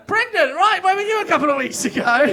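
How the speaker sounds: loud, high-pitched comic exasperation; mock exasperated outburst. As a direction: call it out loud and high, as if badly let down. Comic outburst at audience member's late answer.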